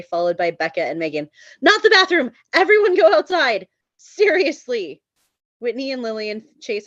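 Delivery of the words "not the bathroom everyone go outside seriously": panicked yell. Acted. Shop owner screaming at sick guests to go outside. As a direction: scream to stop them.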